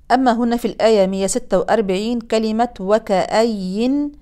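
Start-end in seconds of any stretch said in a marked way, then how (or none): none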